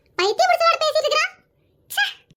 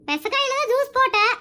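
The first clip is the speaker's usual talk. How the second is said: normal talking voice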